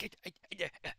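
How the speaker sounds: high-pitched comic yelps; acted yelping. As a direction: play someone sitting on fire, yelping. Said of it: "he's just sitting there like".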